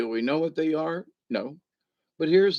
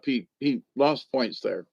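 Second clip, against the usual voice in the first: ordinary talk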